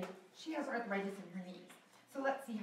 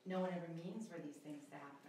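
slower, lower villain line; 6 semitones lower, 10 dB quieter than usual. Shown as a demonstration. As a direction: take your time over each word. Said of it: "I want you to talk slower".

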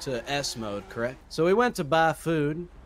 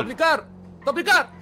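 high, loud, insistent yelling; angry shouted demand; 12 semitones higher, 4 dB louder than usual. Pretend. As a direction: shout over the driver to make them stop. Repeated demand drowning out driver's protests.

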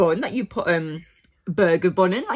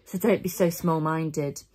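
ordinary talk, nothing marked

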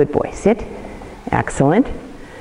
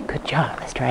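whispered encouragement to the dog; a soft whispered good job to the dog; whispered praise; 2 semitones lower, 9 dB quieter than usual. Real, not acted. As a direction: breathe the praise with no voice.

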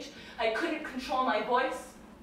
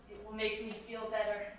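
usual speaking voice

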